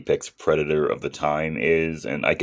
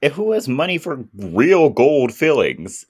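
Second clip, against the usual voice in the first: high-pitched, disbelieving questioning; incredulous; 10 semitones higher, 3 dB louder than usual. Retold or imitated. Host retelling their reaction to gold from teeth, incredulous. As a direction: pitch soars in mock disbelief.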